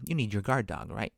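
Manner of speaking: ordinary talk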